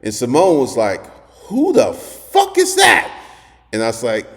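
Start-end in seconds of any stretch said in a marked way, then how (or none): 1.5-3.1 high, slow, drawn-out mock outrage; outraged demand. Girlfriend hears another woman on the phone, imitated high outrage.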